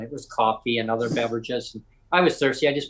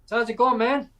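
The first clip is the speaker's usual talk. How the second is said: higher-pitched, casual call to a stranger; breezy retold greeting; 9 semitones higher than usual. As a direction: call out a chummy hello, pitched up.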